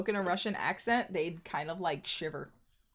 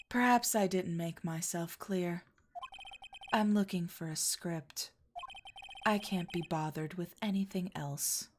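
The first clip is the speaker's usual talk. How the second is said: lightly voiced, softer woman fixated on a script; distracted script-obsessed woman; 3 semitones lower, 6 dB quieter than usual. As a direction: mumble it, lost in her own world. Same game character.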